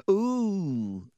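put-on high, wondering boy's ooh; fascinated. A narrator's reading. Colin gasping in fascination at the slugs. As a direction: marvel at the gross sight.

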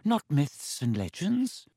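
slow, dry, wheezy lecturer; Professor Binns, dry and wheezy. A narrator's reading. History teacher rebuffing Miss Granger, slow dry wheeze. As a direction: wheeze out each phrase slowly, dismissively. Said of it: "he said in his dry, wheezy voice".

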